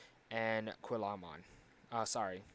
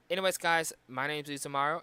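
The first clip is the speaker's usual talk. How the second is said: bright, loud outro greeting; energetic sign-off; 4 semitones higher, 8 dB louder than usual. Real, not acted. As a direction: announce your name like a YouTuber.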